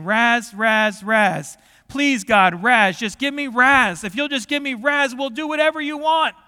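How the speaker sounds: loud, insistent plea climbing in pitch; imitated pleading. Preacher acting the men's prayer to God, pleading. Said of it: "let's talk about the pleading they did".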